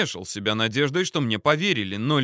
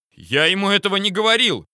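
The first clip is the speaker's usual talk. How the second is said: loud indignant yell; shouting; 9 semitones higher, 5 dB louder than usual. A narrator's reading. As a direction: yell it in outrage. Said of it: "закричал Сливко".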